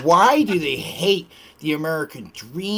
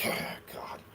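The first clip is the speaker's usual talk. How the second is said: whispered groan of annoyance; exasperated whisper; 14 dB quieter than usual. Retold or imitated. Retelling his reaction to a clueless gamer, whispered groan. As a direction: breathe it out in a frustrated whisper. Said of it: "I'm like".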